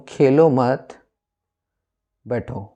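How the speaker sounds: खेलो मत as one phrase, बैठो set apart; pause after मत. Shown as a demonstration. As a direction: break after मत, then drop on बैठो. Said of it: "अगर इसी में मैं लिख दूँ".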